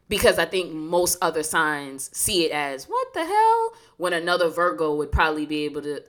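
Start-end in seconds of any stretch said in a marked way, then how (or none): 2.9-3.7 high incredulous exclaim; shocked outsider reaction. Retold or imitated. Other signs reacting, high exclaim.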